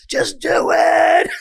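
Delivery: long, drawn-out high shout; exasperated parent yelling. Retold or imitated. Dad at the end of his patience with kid, drawn-out exasperated yell. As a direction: stretch every word into a comic yell. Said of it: "after I yelled at them".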